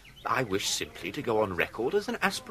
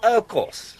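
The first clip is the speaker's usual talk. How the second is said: loud of course; 6 semitones higher, 7 dB louder than usual. Acted. Loud aside to the waiting room.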